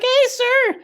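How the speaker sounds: very high squeaky cartoon voice, mocking; squeaky parody voice. Squeaky parody crowd work.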